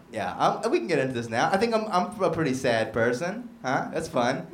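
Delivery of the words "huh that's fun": normal talking voice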